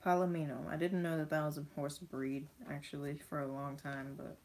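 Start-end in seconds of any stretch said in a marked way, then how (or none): none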